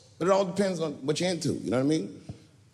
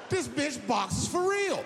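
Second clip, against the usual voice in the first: mock alarm; 9 semitones higher, slower than usual. Alarmed at the boxer.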